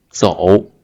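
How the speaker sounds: 走 dipping low; third tone. Shown as a demonstration. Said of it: "the third tone".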